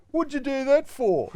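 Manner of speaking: imitating a furious, high-pitched shout; angry yell. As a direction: yell it out in mock outrage, high and loud. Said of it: "Matty Nichols yells out".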